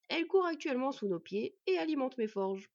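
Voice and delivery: a hint of a dwarf craftsman; proud dwarf smith.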